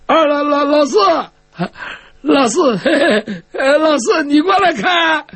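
high calling voice broken by fake laughter; forced laughing call. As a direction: call out, laughing under duress. Forced to laugh while calling fourth brother. (强装笑声，高声呼唤)

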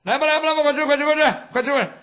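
high, loud repeated hurry-up; urging call. Acted. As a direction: call the whole crowd over to eat. Server calling everyone to come eat. (高声招呼催促)

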